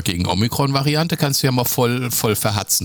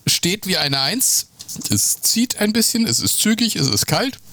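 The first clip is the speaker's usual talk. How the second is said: mock shivering high voice; 10 semitones higher than usual. Shivering in sheepskin in Andes.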